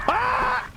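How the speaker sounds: very high scream; screaming for life. Real, not acted. Players fleeing for their lives, screaming.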